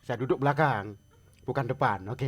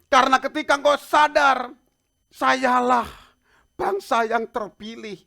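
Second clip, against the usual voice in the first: loud, raised preacher's voice; slow, emphatic proclamation at the end; forceful preaching; 10 semitones higher, 14 dB louder, slower than usual. Acted. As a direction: proclaim it loudly, with weight.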